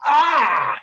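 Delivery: loud, high cry of agony; pained cry. Acted. Gut Ripper cries out as he contorts, loud pained cry. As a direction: cry out as life is drained. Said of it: "he cries out with an".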